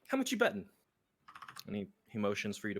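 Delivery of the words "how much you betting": much higher, sly dealer voice; gambler NPC voice. Acted. Gambler telling the adventurer about a job, high voice. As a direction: pitch up and play the smooth card sharp.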